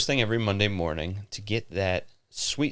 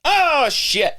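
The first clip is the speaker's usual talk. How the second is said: startled; 7 dB louder than usual. Real, not acted. Sudden mishap during camera setup.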